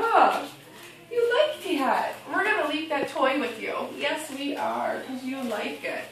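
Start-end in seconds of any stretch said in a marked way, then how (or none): none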